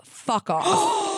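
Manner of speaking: high, strained mock yell of an angry dad; furious outburst. Retelling dad snapping at daughter, a high strained yell. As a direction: snap it out like a cornered old man.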